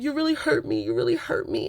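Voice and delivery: very high, pouty imitation of hurt; mock whimpering. Imitating Chelsea complaining to Jimmy, mock high whimper. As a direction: whine it up high like a wounded girlfriend.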